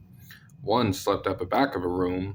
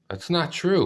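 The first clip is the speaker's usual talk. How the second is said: put-on higher girl voice; lightly voiced girl; 9 semitones higher than usual.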